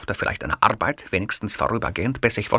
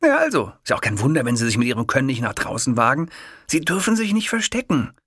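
fast, higher encouragement; urging kiosk man; 6 semitones higher, faster than usual. A narrator's reading. Kiosk man urging Hitler out, fast and eager. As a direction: push him to stop hiding.

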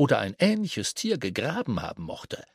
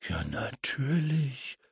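soft, slow murmur to himself; 6 dB quieter, slower than usual. Acted. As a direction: mutter it under your breath. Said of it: "murmelte James vor sich hin".

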